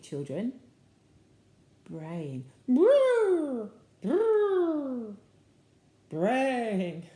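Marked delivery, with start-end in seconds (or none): 2.7-5.1 loud, high trilled bray falling away; zebra bray. Shown as a demonstration. Reader trying a zebra sound for children, braying. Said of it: "let's try and do a zebra sound". 6.1-7.0 the word brayed out, drawn and falling; zebra-like bray. Shown as a demonstration. Reader trying a zebra sound for children, braying it. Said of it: "let's try and do a zebra sound".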